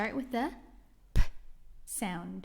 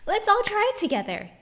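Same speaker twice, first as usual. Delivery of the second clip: eager invitation; 9 semitones higher, 5 dB louder, faster than usual. Acted. Teacher rallying the class.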